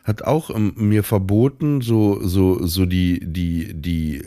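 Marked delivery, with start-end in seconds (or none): none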